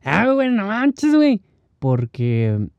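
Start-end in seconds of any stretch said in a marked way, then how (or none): 0.0-1.4 mock Mexican slang. An Argentine imitating Mexicans, in a high exaggerated accent. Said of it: "te llega hablando".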